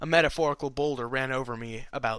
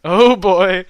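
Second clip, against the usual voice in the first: excited exclaim; 6 semitones higher, 12 dB louder than usual. Real, not acted. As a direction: burst out gleefully. Reader exclaims.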